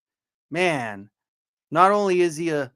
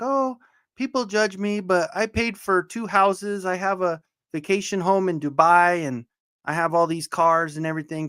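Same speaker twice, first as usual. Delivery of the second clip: higher-pitched imitation of a girl; mock OnlyFans girl; 4 semitones higher than usual. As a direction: mimic a girl justifying herself, pitched up. Mimicking girls on OnlyFans.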